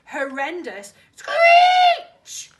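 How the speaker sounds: high, loud, slow shriek of a word; drawn-out screeching climax; dramatic screech. A narrator's reading. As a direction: stretch the scream out. Narrated monster screech climax.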